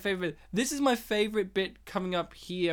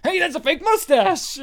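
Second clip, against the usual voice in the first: imitating a sudden loud, high call-out; accusing yell; 8 semitones higher, 7 dB louder than usual. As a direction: cry out as if exposing someone. Acts character's sudden call-out.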